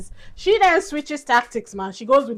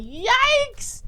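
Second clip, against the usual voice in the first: high squeaky yikes; cringing high-pitched yikes; 13 semitones higher than usual. Retold or imitated. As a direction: recoil with a mock cringe. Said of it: "And I was like".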